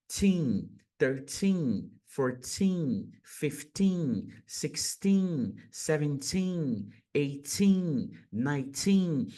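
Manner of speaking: put-on each TEEN ending held long and clear; careful teacher's drill, long ee in every teen; well-marked teen endings. Shown as a demonstration. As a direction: lean on the final TEEN, letting it fall. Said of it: "deixem assim bem marcado".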